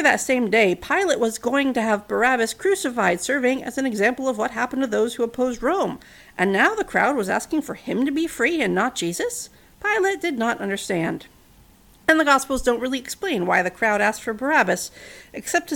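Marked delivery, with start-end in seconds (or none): none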